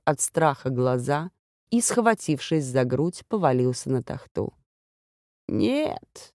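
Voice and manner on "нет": high, soft, unhurried woman's no; calmly, from out of the dark; calm voice from darkness. Acted. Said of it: "спокойно ответил женский голос из темноты".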